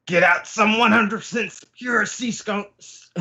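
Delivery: high, urgent mock panic; panicked orders. Queen panics and orders restraints for Big Mom, high urgent voice. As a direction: cry out the order in a hammed-up panic. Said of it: "he panics and goes".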